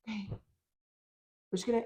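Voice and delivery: deflated and slow after a sigh, almost under the breath; defeated sigh. Retold or imitated. Kids dragging back onto the field, deflated and slow. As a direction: let the air out, beaten before they start.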